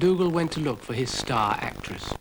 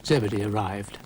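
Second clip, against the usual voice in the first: ordinary delivery, nothing marked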